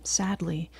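ordinary delivery, nothing marked

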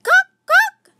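high, loud squawking peacock call; peacock cry. A narrator's reading. Peacocks crying out in alarm, high and loud. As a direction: shriek it like a startled peacock. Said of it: "The peacocks cried".

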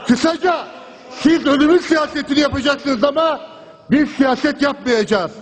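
usual speaking voice

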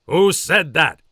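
loud demand; loud drill sergeant bark; drill sergeant yell. Acted.

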